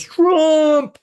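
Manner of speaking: long, high, loud holler; shouting heckler. Retold or imitated. Heckler answering the comic from the crowd, shouted triumphantly. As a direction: imitate a heckler yelling it out, drawn out. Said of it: "like this, with his arms up".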